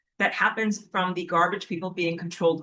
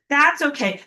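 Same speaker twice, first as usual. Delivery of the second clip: higher, mock-pleasant reassurance; fake smile; 6 semitones higher than usual. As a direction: imitate someone smiling falsely. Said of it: "smiling fake".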